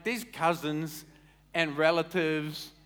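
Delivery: ordinary talk, nothing marked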